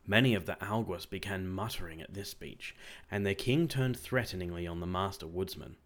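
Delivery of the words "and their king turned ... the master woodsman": plain reading voice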